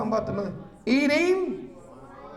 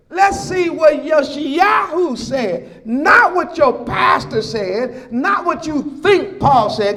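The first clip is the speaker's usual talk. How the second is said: louder, slow pulpit call; forceful preaching; 5 semitones higher, 5 dB louder, slower than usual. Acted. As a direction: hammer each line to the congregation.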